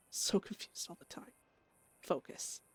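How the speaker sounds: unvoiced muttering to herself; faint whispered self-talk. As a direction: whisper it like a secret voice. Whispered inner voices.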